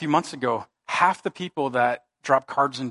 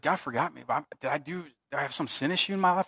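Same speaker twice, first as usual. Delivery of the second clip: quiet despair; 7 dB quieter than usual. Retold or imitated. Retold despairing prayer.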